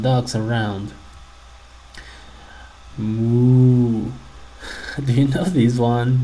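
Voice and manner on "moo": cow moo. Shown as a demonstration. Teacher imitates a cow.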